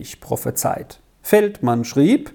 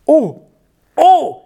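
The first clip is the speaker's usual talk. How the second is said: high, loud gasp of amazement; wide-eyed boy crying out; boy amazed at who wrote the letter; astonished cry; 13 semitones higher, 7 dB louder than usual. A narrator's reading. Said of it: "rief Georg bei weit aufgerissenen, erstaunten Augen aus".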